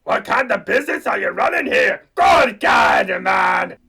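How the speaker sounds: loud, indignant bellow; booming outrage; outraged shout. Acted. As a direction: shout him down in disgust.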